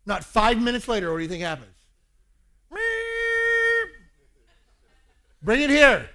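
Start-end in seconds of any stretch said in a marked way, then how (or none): 2.7-3.9 high shrill recorder toot; a sudden piercing recorder note; imitated recorder squeak. Son blows the recorder again. 5.4-6.1 much louder, higher demand; retold angry dad, loud; stern command.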